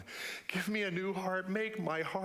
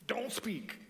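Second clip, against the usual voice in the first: a higher, urgent conscience voice cutting in; higher-pitched warning voice; inner voice; 6 semitones higher than usual. Acted. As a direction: stop yourself before you say it. Inner voice argues against speaking.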